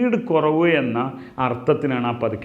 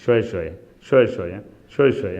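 low repeated demo; 6 semitones lower, much faster than usual. Shown as a demonstration. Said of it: "രണ്ട് വട്ടം ഷ്വയ്യ പറഞ്ഞാൽ മതി".

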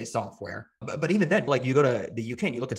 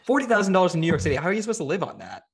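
louder, higher and fast, indignant; outraged disbelief; 6 semitones higher, 5 dB louder than usual. Real, not acted. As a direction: throw up your hands at the number. Outraged at a low salary.

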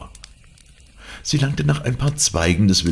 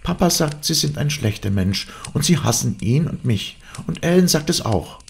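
put-on high girlish indignation; high-voiced, defiant girl; accusing young Cathy; 11 semitones higher than usual. A narrator's reading. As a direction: throw the accusation at him, pitched high.

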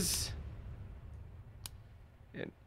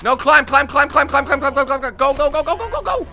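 high rapid urging; frantic urging; 7 semitones higher than usual. Real, not acted. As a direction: chant it in panic. Urging character to climb.

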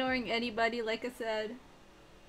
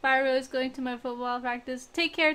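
ordinary talk, nothing marked

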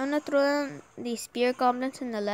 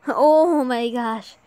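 surprised; 10 dB louder, much slower than usual. Real, not acted. Reacting to big damage.

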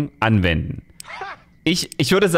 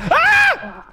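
high sudden shriek; scared shriek at a jump scare; startled scream. Real, not acted. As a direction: yelp in fright. Jump scare in horror game.

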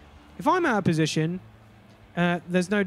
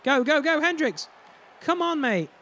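urging, pitch rising high; excited cheering; 6 semitones higher than usual. Real, not acted. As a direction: cheer him on eagerly. Cheering teammate on.

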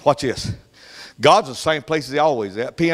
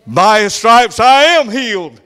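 loud, higher, slow proclamation; triumphant declaration; 5 semitones higher, 6 dB louder than usual. Retold or imitated. The healed mother proclaiming victory, loud and slow. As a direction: finally declare it with full faith. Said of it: "She's speaking his executive order".

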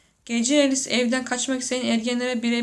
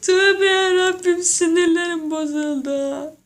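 much higher, louder, slow sing-song wail; wailing exasperation; 6 semitones higher, 4 dB louder, slower than usual. Pretend. Laughing viewer lamenting in a slow, hammed-up wail. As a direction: drag it out like a lament.

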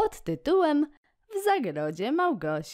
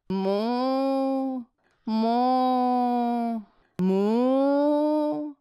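long higher moo; cow mooing; 5 semitones higher, 4 dB louder, much slower than usual. Acted. Reading the poem to kids, a long drawn-out moo. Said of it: "Krowa ryczy".

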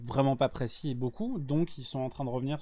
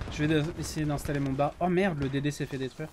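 usual speaking voice

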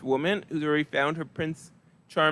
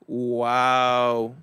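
deadpan, no excitement; flat monotone wow; 4 semitones lower than usual. Pretend. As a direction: say wow with zero emotion. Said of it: "hard time reading and showing emotions".

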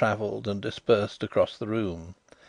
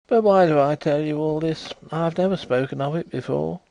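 ordinary delivery

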